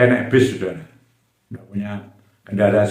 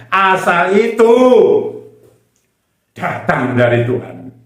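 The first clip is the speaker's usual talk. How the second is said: loud, high sermon proclamation; impassioned pulpit shout; full-force preaching; 7 semitones higher, 10 dB louder than usual. Real, not acted. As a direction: drive it home to the congregation at full volume.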